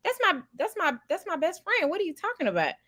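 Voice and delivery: high-pitched retold objection; imitating indignant disbelief; mock protest. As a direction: protest it high, as if falsely accused. Retold protest to a jealous date.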